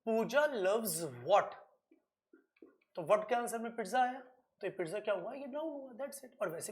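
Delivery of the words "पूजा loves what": very slow, each word spelled out for the class; slow teacher dictation of a question. Shown as a demonstration. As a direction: draw out the question for the board. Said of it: "मैंने question put किया".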